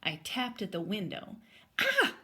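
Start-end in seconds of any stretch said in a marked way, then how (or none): 1.8-2.2 sudden startled shriek, very high. A narrator's reading. Goldilocks startled at the window, high shriek. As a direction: shriek as the bear taps the window. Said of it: "Goldilocks shrieked".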